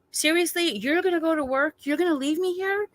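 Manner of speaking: high, pleading disbelief; freaking out. Retold or imitated. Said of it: "I was freaking out".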